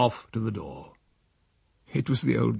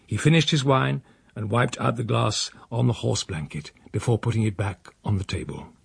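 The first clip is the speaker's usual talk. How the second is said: ordinary delivery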